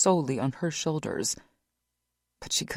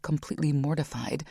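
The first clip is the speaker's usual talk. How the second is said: ordinary delivery, nothing marked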